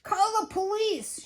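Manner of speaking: high-pitched shouted demand. A narrator's reading. Furious museum man demanding police, high shout. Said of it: "shouted Mister Frizzle".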